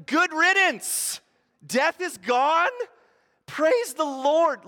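high, loud, slow triumphant praise; joyful praise. Pretend. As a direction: savor each word of victory. Imagined rejoicing at death gone.

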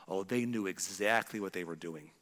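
low, quiet grudging accusation; knowing accusation. Acted. Wronged person about those who hurt them, low knowing accusation. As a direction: mutter it low, sure they meant it.

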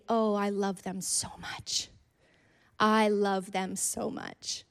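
slow, tender declaration of love; voicing God's love. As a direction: linger lovingly, as God would answer. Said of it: "the answer will always be".

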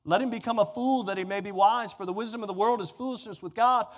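ordinary talk, nothing marked

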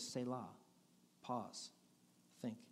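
quiet, spaced out meditative reading; pausing slowly. Shown as a demonstration. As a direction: let each word hang before the next. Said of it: "And then it says".

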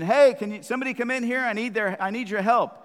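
high, loud call from the kitchen; mom yelling. Retold or imitated. Mom yells to the kids from the kitchen, loud call. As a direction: call out like a mom who needs help. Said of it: "mom yells from the kitchen".

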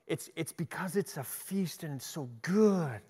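ordinary delivery

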